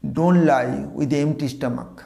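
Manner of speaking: very slow, word by word; slow example sentence. Shown as a demonstration. Teacher dictating an example very slowly. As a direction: read it slowly for students. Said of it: "এবার যদি বাক্য বলি".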